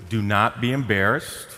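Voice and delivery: usual speaking voice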